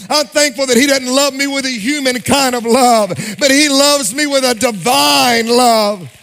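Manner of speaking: loud, rising sermon climax, calling the church to love; fiery preacher rousing his church; preaching at full force. Acted.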